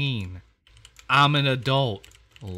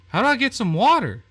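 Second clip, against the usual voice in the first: higher, louder exasperated yell; fed up, shout it at the game; frustrated shout; 10 semitones higher, 7 dB louder, faster than usual. Real, not acted. Stuck player yells at the game, fed up. Stuck trying to get water.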